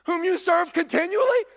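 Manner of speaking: high, loud desperate shout; anxious king calling. Acted. The king shouting down to Daniel in the den, high and loud. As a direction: yell down into the pit for an answer.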